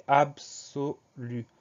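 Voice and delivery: ABSOLU very slowly, in separated syllables; "absolu" broken up slowly, syllable by syllable. Shown as a demonstration. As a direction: stretch out "absolu" for a speller. Teacher breaks the word into syllables.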